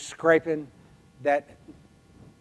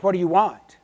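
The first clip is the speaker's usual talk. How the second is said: loud, higher-pitched startled challenge; startled demand; 4 semitones higher, 10 dB louder than usual. Retold or imitated. Neighbor startled by offer of help while scraping, sharp and loud. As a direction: jump and demand it, voice raised. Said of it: "And she jumped".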